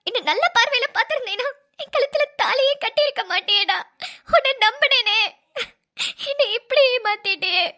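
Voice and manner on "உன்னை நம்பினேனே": ordinary talk, nothing marked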